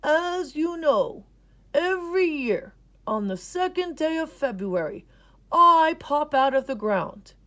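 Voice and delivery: grandpa's wise explanation; old grandpa explaining. A narrator's reading. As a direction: lay out the rules like a wise elder. Grampy's held voice.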